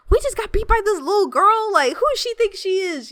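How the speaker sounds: high-pitched, loud mock indignation; imitated outrage. Beaten hunter warriors outside the bar, mock outraged. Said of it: "they're all so mad".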